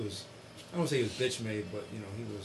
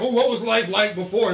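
loud, high mock ad line; hammed-up advertising question; mocked car commercial; 6 semitones higher, 8 dB louder than usual. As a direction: mimic a stupid commercial, pitched up. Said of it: "it's a stupid ass car commercial".

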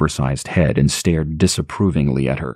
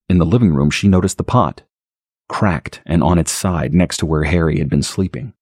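ordinary delivery

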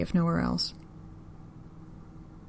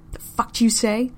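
angry man; 5 semitones higher, 5 dB louder than usual. A narrator's reading. Man yanking her hair.

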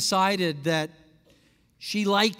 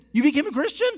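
high, loud amazed question; delighted disbelief at her news; astonished; 9 semitones higher, 5 dB louder, much faster than usual. Retold or imitated. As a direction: burst out in disbelief. Pastor astonished at her conversion.

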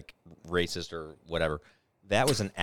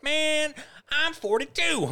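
much higher, louder mock protest; imitated indignant coworker; 7 dB louder, much slower than usual. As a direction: exclaim it high and loud, imitating someone. Acted exchange about guessing age.

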